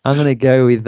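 ordinary talk

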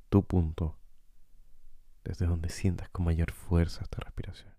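calm guide with long, unhurried pauses; guided meditation. Acted. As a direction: lead listeners gently through breathing, leaving space between phrases. Guía una meditación de respiración. (meditación guiada, tranquila y pausada)

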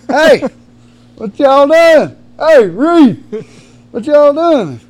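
high, loud, drawn-out calls; booming, stretched-out greeting; hollering into house. Acted. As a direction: holler through an empty house for your buddies. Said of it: "he walks into the house thinking we're at home".

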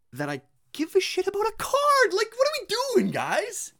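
loud, high exasperation; exasperated rant. Real, not acted. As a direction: blow up in disbelief at the whole idea. Ranting about cards.

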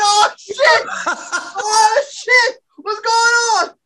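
high alarmed cries, laughing through them; shocked shouting. Retold or imitated. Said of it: "We all started".

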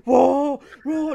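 high, loud cheering wows; imitating an overexcited spotter, shouting; mock hype shout. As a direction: hype up your buddy at the bench. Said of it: "el otro mae".

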